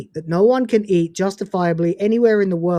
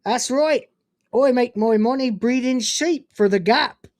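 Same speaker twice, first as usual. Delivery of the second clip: hammed-up Cornish farmer, pitched higher; Cornish accent; 4 semitones higher than usual. Shown as a demonstration. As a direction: play a proud Cornish sheep breeder. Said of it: "in a Cornish accent".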